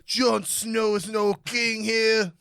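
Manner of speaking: slow, loud northern brogue; gruff Game of Thrones northerner; northern accent. Pretend. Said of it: "You're like a northerner?".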